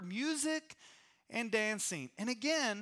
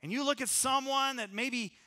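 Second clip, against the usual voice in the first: ordinary talk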